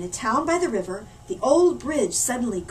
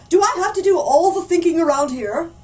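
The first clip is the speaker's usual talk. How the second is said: loud, much higher blustering complaint; indignant mayor thundering; pompous thunder; 7 semitones higher, 9 dB louder than usual. Acted. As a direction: bluster like an overworked boss. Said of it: "thundered the mayor".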